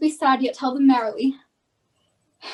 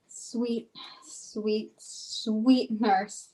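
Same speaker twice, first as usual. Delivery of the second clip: soft coaxing; 5 semitones lower, 7 dB quieter, much slower than usual. Acted. Juliet coaxes the nurse.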